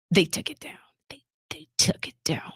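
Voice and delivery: angry retold whisper, voice dropping away; seething anger. Mom insists to her daughter at the doctor's, seething. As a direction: let it sink into a furious whisper. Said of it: "she's so fucking angry".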